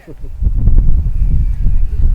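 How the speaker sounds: breathy villain chuckle; gloating laugh. Acted. Grey Klaus gloating after the blow, breathy chuckle. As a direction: chuckle over the deed.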